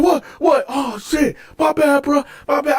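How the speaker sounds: high, flustered imitation of cellmate; mock panicked backpedaling; panicked apology.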